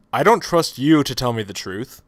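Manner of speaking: normal talking voice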